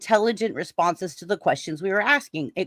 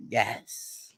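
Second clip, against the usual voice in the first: much lower, softer eerie reply; weird ghostly yes; 9 semitones lower, 8 dB quieter than usual. Retold or imitated. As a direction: imitate a ghost's strange low answer. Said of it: "the tone of the yes was so weird".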